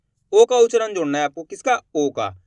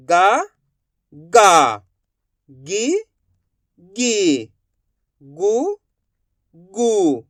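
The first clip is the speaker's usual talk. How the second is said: alternating rising and falling syllables; drawn-out drill with a pause after each syllable; repeat-after-me recitation; 6 dB louder, much slower than usual. Shown as a demonstration. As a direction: recite the barakhadi for pupils to echo. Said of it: "मैं किस तरीके से इनका उच्चारण कर रहा हूँ".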